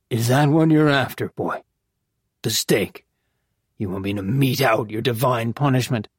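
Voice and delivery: hint at a grudging, weary cleric; lightly voiced gruff priest. A narrator's reading.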